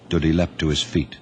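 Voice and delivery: ordinary delivery, nothing marked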